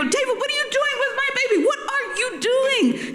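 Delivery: very high-pitched outraged shriek, half laughing; outraged yell. Retold or imitated. Mother finding father screaming at her baby, outraged high yell. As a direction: screech it, slowing on the last question. Mother confronts him, retold.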